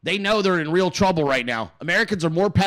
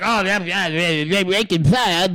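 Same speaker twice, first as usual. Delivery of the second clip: mocking voice. Host mocking a critic of the FBI, lightly voiced sneer. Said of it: "you're like".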